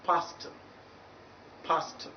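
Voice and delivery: "pastor" ending in a soft, weak "tuh"; correct final schwa. Shown as a demonstration. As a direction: let the end of "pastor" drop to a short schwa. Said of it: "then you pronounce it as".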